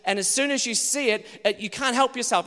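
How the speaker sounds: normal talking voice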